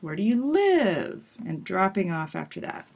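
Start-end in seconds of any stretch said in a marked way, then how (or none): 0.0-1.2 "live" high, then the voice drops off; focus-word peak demo; peak and fall. Shown as a demonstration. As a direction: peak on live, drop after. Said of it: "With the peak word".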